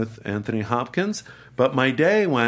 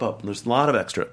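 usual speaking voice

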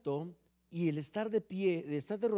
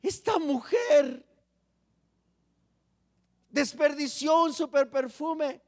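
mock outraged shout, higher and louder, slow; burla indignada; 7 semitones higher, 6 dB louder, slower than usual. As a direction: play a scandalized onlooker sneering at her. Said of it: "así como diciendo". (grito burlón de espectador escandalizado, más agudo y fuerte)

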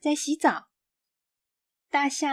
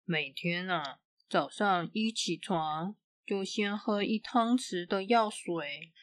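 doctor's voice; 5 semitones lower, 6 dB quieter than usual. A narrator's reading. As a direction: advise like a calm old doctor. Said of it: "医生对小琪说".

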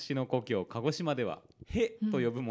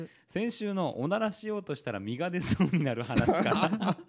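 ordinary talk

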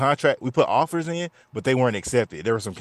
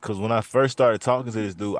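normal talking voice

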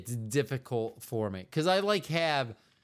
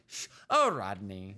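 put-on Rebecca voice; 6 semitones lower than usual. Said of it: "Just a voice that's not my own".

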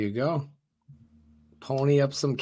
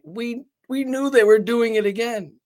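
quoting players; 9 semitones higher, 4 dB louder than usual. Retold or imitated. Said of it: "were joking, like".

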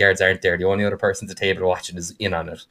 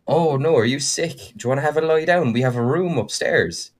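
higher, sugary fake worry; mock concern; 7 semitones higher than usual. Rogues feigning concern to their drugged mark, higher and sweet. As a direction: pretend to care about the sick mark.

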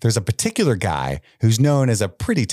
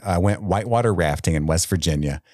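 ordinary talk, nothing marked

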